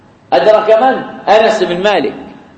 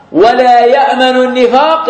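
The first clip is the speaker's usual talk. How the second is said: normal talking voice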